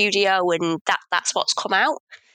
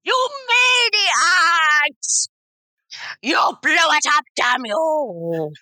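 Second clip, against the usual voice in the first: Doctor Evil hamming up Heston's despairing cry; hammed-up Charlton Heston; 7 semitones higher, much slower than usual. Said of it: "[name] doing an impression of Doctor Evil, doing an impression of Charlton Heston".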